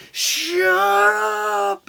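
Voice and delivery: put-on high, loud, fed-up girlfriend voice; annoyed girlfriend. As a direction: girlfriend shutting him up. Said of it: "my girlfriend at the time was like".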